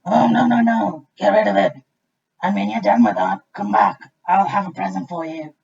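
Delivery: loud booming crocodile; big crocodile voice. A narrator's reading. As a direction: boom like a grateful crocodile. Crocodile refuses the tooth.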